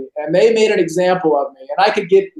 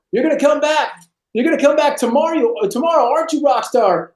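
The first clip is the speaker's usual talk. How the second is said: high, quick sarcastic sergeant voice; taunting drill instructor; 8 semitones higher than usual. Retold or imitated. Drill instructor ordering the rock star to cut sideburns, taunting.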